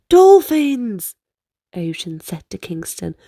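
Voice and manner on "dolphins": louder, higher cry of discovery; thrilled kid spotting dolphins; excited shout. Acted. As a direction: point and call it out. Boys spot dolphins.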